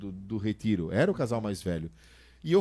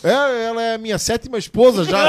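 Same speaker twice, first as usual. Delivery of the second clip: put-on high, loud punchline in the old husband's part; retold comic confession; imitated old husband; 8 semitones higher, 10 dB louder than usual. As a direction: play the little old man's proud reply, raised and loud. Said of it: "olhou pra mim e disse assim".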